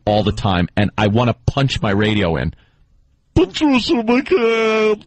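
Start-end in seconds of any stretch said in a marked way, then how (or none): 3.3-5.1 high, slow, exaggerated announcer voice; Patricia McCann impression. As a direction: drag every word out high in mockery. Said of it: "she got the voice that sounds like this".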